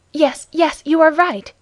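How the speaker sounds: alarmed woman, voiced by a narrator; affrighted cry. A narrator's reading. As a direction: agree urgently, with a touch of fright. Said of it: "cried the affrighted Mme".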